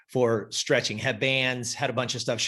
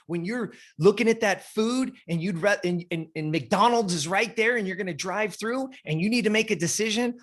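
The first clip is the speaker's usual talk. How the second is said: higher, pushing motivational speech; rally her, pitched up and driving; fired-up pep talk; 6 semitones higher than usual. Retold or imitated. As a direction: coach firing up a new client, higher and urgent. Coach preaching motivation to client.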